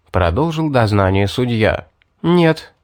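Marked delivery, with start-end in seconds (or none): 2.2-2.7 резко. A narrator's reading. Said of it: "отрезала Ребекка".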